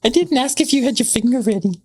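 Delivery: high mock falsetto; high squeaky voice. High put-on voice.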